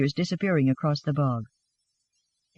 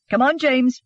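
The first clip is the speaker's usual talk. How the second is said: higher-pitched, brisk order; angry urging; 5 semitones higher, 4 dB louder than usual. A narrator's reading. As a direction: summon him in a huff. Anna angrily leads James away.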